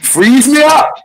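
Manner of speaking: surprised it froze for real; excited. Real, not acted. Stream froze, excited reaction.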